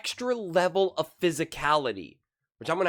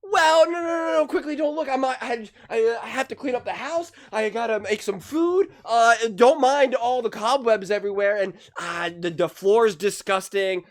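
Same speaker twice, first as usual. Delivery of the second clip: frantic, much higher, fluttering host voice; mock panicked host; 5 semitones higher, 4 dB louder than usual. Commentator playing the league as a panicked host, frantic and high. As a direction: scramble to hide the mess, mock panic. Mocking league hiding its flaws.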